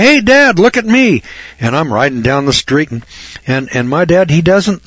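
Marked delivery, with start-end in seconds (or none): 0.0-1.2 put-on high, loud call for attention, kid showing off; calling out to dad. Eleven-year-old calling to his dad to watch his bike tricks, high and loud.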